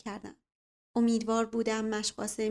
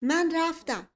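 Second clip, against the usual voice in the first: high panicked shout; 7 semitones higher, 6 dB louder, slower than usual. Acted. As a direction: yell it as you flee. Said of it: "اختر فریاد زد".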